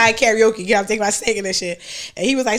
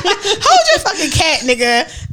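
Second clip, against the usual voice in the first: higher, slow laughing jab; laughing mock retort; 5 semitones higher, much slower than usual. Mocking the guy who wanted a cat, laughing. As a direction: tease him through laughter.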